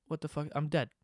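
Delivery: usual speaking voice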